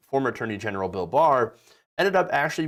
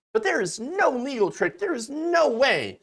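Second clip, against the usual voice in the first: mock exasperated Barr; 11 semitones higher than usual. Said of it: "William Barr is like".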